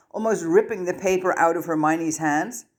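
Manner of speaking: ordinary delivery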